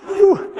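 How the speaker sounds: high gasping whew from the burn; sharp high whew after horseradish. Real, not acted. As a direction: blow out the heat with a high whew. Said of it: "It's burning my nose".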